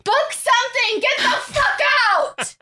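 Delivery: high yelling wife; angry shout. Pretend. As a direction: scream her out of the house.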